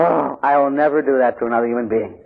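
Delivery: ordinary talk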